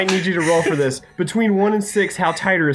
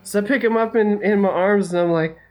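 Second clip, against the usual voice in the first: ordinary talk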